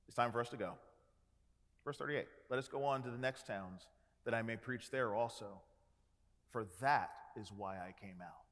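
quietly decided, no argument; calm resolve. Retold or imitated. Jesus answering his eager disciples, calm and low.

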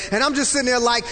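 ordinary delivery

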